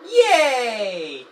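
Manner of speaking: high, loud cheer; joyful cheer. Real, not acted. Player who made an animal friend, high joyful cheer. Player celebrates gaining the dog.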